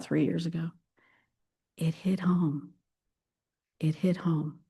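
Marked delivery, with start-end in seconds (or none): 1.8-4.6 softer hushed admission; whisper. Real, not acted. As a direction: let it land in a breath. Emotional admission.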